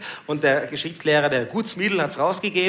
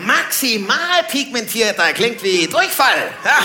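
higher, slow comic indignation; indignant disbelief; 7 semitones higher, 5 dB louder, slower than usual. Acted. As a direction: stretch it out in mock outrage. Indignant reaction to a label.

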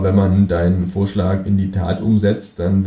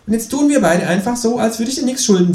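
high, cheeky kangaroo voice; 4 dB louder than usual. Acted. Kangaroo asks narrator to shove over, high cheeky. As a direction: pitch way up for the cheeky marsupial.

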